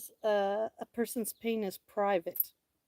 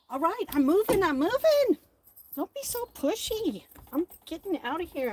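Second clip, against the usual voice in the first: high exasperated; 8 semitones higher than usual. Real, not acted. Complaining to pushy birds.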